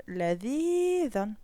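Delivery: لذيذًا drawn out syllable by syllable; لذيذًا slowly, every sound clear; لذيذًا ببطء; slow teaching read. Shown as a demonstration. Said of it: "وهنا لدينا لذيذ".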